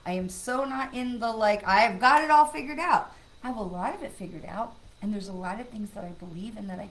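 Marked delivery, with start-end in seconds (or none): 1.7-3.1 high, loud mock boast; mock know-it-all. Mocking the idea she has it all figured out, loud and high. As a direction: ham up the smug claim.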